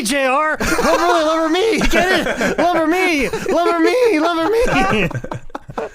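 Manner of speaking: high, loud laughing commentator voice; hammed-up cackling announcer; JR impression. Impersonating JR commentator, hammy.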